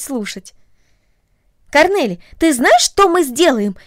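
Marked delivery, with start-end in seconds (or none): 1.7-3.8 put-on eager girl. A narrator's reading. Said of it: "сказала Агнес".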